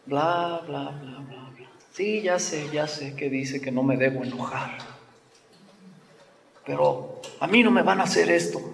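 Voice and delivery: dismissive attitude. Retold or imitated. Believer brushing off a Bible passage, dismissive and soft. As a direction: brush off the scripture you don't like. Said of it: "tenemos la actitud de decir".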